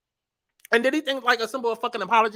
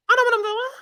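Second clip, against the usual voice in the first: much higher, louder put-on squeal; squeaky mock insistence; 12 semitones higher, 4 dB louder, faster than usual. Commentator mocking the passenger, high squeal. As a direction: squeal it like a defensive fool.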